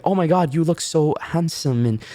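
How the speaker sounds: high fawning compliments; gushing beautician. Retold or imitated. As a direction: imitate the salon staff buttering up a client. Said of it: "said same thing, like".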